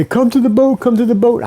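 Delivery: high, loud call across the water. Retold or imitated. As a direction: friends shouting to a struggling diver. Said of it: "You say".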